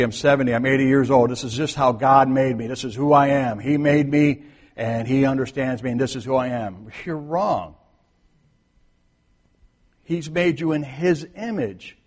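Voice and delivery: ordinary talk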